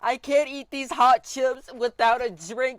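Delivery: high, loud, slow hammed-up begging; over-the-top whiny pleading for his money; mock crying plea. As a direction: beg the machine like a wailing child. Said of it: "crying and pleading to the vending machine".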